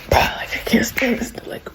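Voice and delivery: imitating a fed-up whisper; exasperated whisper. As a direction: whisper it under your breath, fed up. [name] storms off, muttering.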